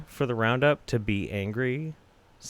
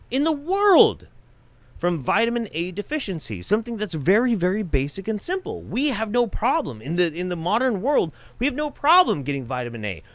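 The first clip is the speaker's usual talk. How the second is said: furious rant; 9 semitones higher than usual. Real, not acted. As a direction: rage at people who don't care. Ranting about vitamin A deaths.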